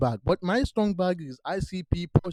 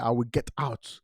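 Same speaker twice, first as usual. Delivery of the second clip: ordinary delivery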